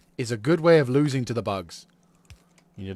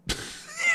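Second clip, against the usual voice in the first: shrill villain cackle, very high; high evil cackle. Pretend. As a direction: cackle like a mad genius. Boasting about his genius plan.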